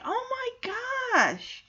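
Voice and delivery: high, drawn-out gasp of alarm; shocked dismay. Retold or imitated. Viewer sees the pregnant mom escaped, high shocked dismay. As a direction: stretch it slowly up high, softly aghast. Reacting to pregnant woman in fire.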